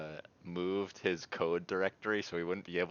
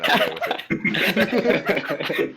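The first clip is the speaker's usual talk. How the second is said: laughing; 11 semitones higher, 10 dB louder than usual. Real, not acted. Cracking up at punchline.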